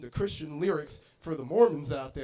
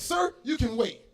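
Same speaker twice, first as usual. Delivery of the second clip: dismissive waitress; 6 semitones higher than usual. Acted.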